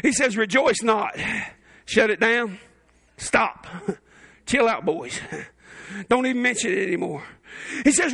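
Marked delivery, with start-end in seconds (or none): 0.4-7.2 lightly voiced Jesus, deadpan and low; Jesus shutting it down.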